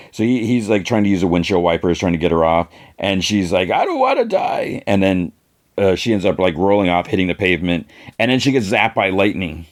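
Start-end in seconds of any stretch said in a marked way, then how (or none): 3.7-4.8 very high squealed mock plea; panicked plea. Woman clinging to the car pleads for life, mock panicked squeal. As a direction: imitate a desperate high-pitched wail. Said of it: "she's screaming his name".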